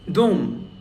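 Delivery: "Dom" with a full, stressed O. Shown as a demonstration. Teacher repeating the example word, stressed O in "Dom". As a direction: say "Dom" with a strong, clear O. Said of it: "so that is stressed".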